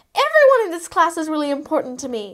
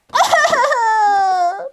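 mock sobbing wail; 2 semitones higher than usual. [name] cries after being betrayed.

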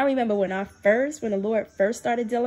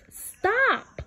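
high sharp stop; 11 semitones higher than usual. Retold or imitated. God's firm rebuke quoted.

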